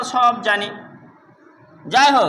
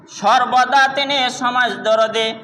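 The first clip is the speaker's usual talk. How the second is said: ordinary delivery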